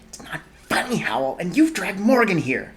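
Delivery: scolding. Acted. Sophie scolds Howl.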